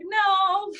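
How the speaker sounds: high-pitched retold "no"; sheepish high protest; embarrassed denial. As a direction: deny it high, breaking into a laugh. Retelling own embarrassed denial, laughs.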